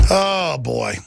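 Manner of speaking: normal talking voice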